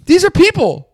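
high, loud outraged telling-off; furious imagined rant. Retold or imitated. To the guy who shoved past, an imagined high, loud rant. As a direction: let him have it, way up high. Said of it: "I wanna be like".

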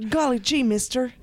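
put-on high, eager little boy; gee-whiz paperboy voice; wholesome 1950s kid. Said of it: "it's just a small boy".

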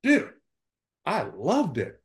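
high, gleeful outburst, imitated; high-pitched, punched enthusiasm; retold delight. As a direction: imitate a manager letting on he loved it. Retells manager's unexpected delight.

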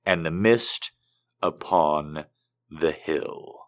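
slow, grave gothic recitation; dramatic poem reading. Acted. Host reciting Poe to listeners, slow and dramatic. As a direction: intone Poe to the room, slow and heavy.

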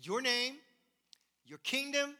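"your" held up high and loud in each phrase; stress on your. Shown as a demonstration. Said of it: "the emphasis is not on us".

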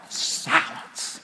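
whispered silence, with a laugh; a whispered one-word pause; hushed. Retold or imitated. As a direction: drop to a breath for the hush. After mocked bickering.

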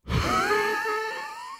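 high-pitched winded wheeze; thin gasp with the breath knocked out. A narrator's reading. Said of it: "Calvin wheezed as he went to get up".

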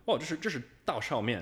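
high, loud imitation of a Mandarin speaker; locals naming the dish. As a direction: exclaim the dish name as a local would. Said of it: "and they're like".

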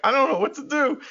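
whiny groan. Retold or imitated. Host imagines co-host groaning in bed.

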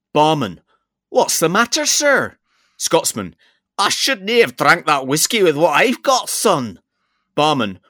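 hammed-up Scottish accent, building to a high punchline. Shown as a demonstration. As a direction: joke told in a loud, high Scottish accent. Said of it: "have to do it in a Scottish accent".

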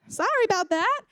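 high, tentative acted apology; sheepish mock sorry; awkward apology. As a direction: offer it high and unsure. Debbie's awkward reaction to crying girl.

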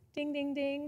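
high, sing-out "ding, ding, ding"; mock bell dinging. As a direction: imitate a game-show bell. Imitates a bell for right answer.

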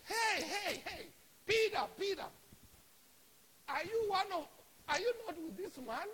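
loud, high calling out; acted excited accusation; accusing call. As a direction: shout to single him out. Acts accuser calling out Peter.

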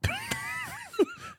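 high burst of laughter; real helpless giggle; incredulous laugh. Real, not acted. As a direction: crack up at your friend's answer. Laughs at friend's baby answer.

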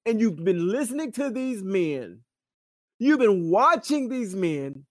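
high, insistent pulpit delivery; impassioned preaching. Real, not acted. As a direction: paint the scene for a congregation, pitched high.

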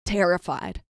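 ordinary talk

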